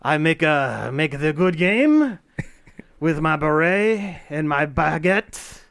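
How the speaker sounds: slow, exaggerated French accent. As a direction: play the cliché Frenchman, slowly. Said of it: "my French accent".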